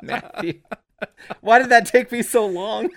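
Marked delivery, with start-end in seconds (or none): 1.4-2.9 high, loud cry of mock despair at himself; exasperated shout. Real, not acted. Host shouting at himself after finally getting the pun, loud and high.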